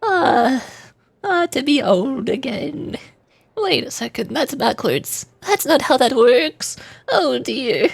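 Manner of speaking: slow, put-on elderly woman; hammed-up granny, swooping in pitch; old lady voice. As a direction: dodder slowly like a little old lady. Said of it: "Just become the old lady".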